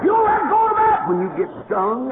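high, loud warning yell; mock shout. Preacher yells a high loud warning at his dog. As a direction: yell the warning, half laughing.

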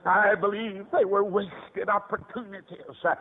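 usual speaking voice

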